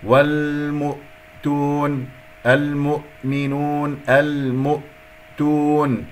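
والمؤتون with short mu, cut at the hamza; slow recitation, damma not dragged; correct hamza demo. Shown as a demonstration. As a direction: stop cleanly after mu in المؤمنون and المؤتون. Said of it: "لا أسحب ضمة الميم إلى الهمزة".

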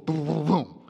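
low thudding booms; imitated turbulence booms. Preacher imitates turbulence for the congregation, low thudding booms. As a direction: thump out the turbulence.

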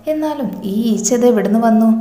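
plain reading voice

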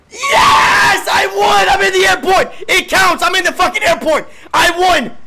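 loud, high victory celebration; triumphant shouting. Real, not acted. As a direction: scream your win to everyone. Celebrating winning the game.

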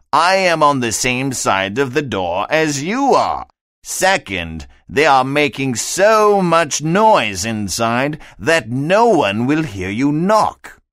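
a fussy fish explaining from the doorstep. A narrator's reading.

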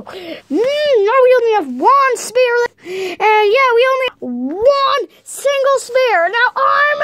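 high, drawn-out angry whine; mounting tantrum in a high voice; worked-up anger. Acted. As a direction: build from exasperated complaint to a shout. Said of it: "I don't know how I can be even madder".

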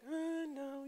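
high, dismissive "uh, no"; unimpressed refusal. Retold or imitated. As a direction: wave the car off with a sniffy no. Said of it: "And I was like".

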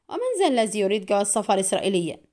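ordinary delivery

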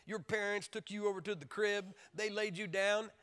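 ordinary talk, nothing marked

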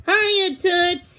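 high, loud cheeky greeting; giddy high-voiced hello; giggly. A narrator's reading. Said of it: "he giggled".

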